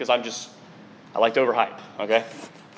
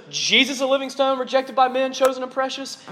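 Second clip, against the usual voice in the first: lifted higher quoting voice; Scripture quoted in a raised, ringing voice; quoting Peter; 8 semitones higher than usual. Retold or imitated. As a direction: read the verse up high, proclaiming. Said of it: "so he says".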